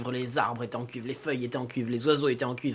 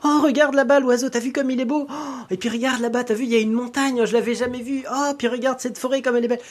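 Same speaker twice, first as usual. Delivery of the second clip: put-on high, louder girlish voice marvelling; full of wonder; 10 semitones higher, 4 dB louder than usual. Girl on the cow marvelling at the landscape, high excited voice. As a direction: point at everything like a child seeing the world first time. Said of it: "tout l'émerveillait".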